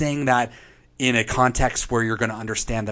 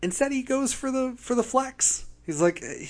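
mock Night King voice. Mocking the Night King's flex.

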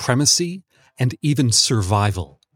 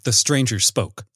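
ordinary delivery

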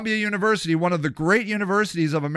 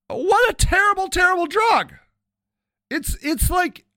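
mock outrage; 13 semitones higher than usual. Mock dramatic outcry about alcohol.

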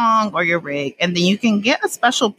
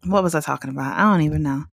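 ordinary talk, nothing marked

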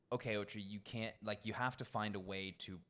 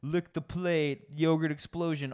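menu showmanship; 7 semitones higher, 7 dB louder, slower than usual. Retold or imitated. Quoting dish names on the menu.

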